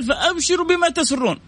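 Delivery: high hopeful voice giving good news; quoting the Prophet. Acted. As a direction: announce glad tidings warmly. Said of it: "قال".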